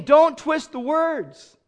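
slow, high outraged warning; Paul warning, slow and outraged. Retold or imitated. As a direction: warn them hard and slow. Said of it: "He's outraged".

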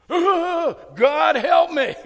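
high, loud, slow laughing cry to God; comic desperate plea, laughing; laughing mock plea. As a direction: ham up a panicked prayer through laughter. Mock panicked prayer, laughing.

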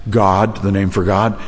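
usual speaking voice

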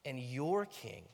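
"your" drawn out slowly; stress on YOUR. Shown as a demonstration. Preacher making the king personal, stress on "your". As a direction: lean long on the word "your". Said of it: "Emphasis on your".

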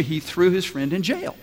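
ordinary talk

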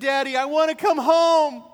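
high, loud imitated wail; mock tearful daughter. Newly married daughter begging her father, tearful. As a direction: plead to Daddy in a hammed-up cry. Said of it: "In tears".

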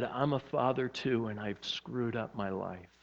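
low, hushed, understated sorrow; retold sobbing confession. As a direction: confess it softly, sinking almost to nothing. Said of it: "He began to sob".

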